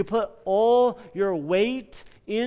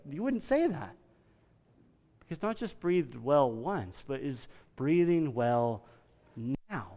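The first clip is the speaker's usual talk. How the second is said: ordinary delivery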